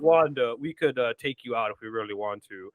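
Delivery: low, mock-cocky threat; cocky posturing. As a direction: puff up the chest, imitating a low-voiced tough guy. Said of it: "chest all flexed and shit".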